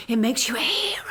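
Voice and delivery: taunting whisper. Acted. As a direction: breathe it at him like a secret. Sarcastic taunt to the professor.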